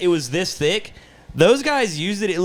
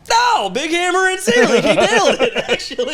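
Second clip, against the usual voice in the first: higher, louder laughing shout; mock shout; 3 dB louder than usual. Yelling at the worker from the attic in a high laughing shout. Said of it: "I'm like".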